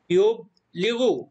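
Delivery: يبلغ drawn out slowly with the final غ clear; teacher sounding out يبلغ; slow model reading. Shown as a demonstration. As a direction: read يبلغ slowly for the class. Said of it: "لذلك لا ننطق الحرف".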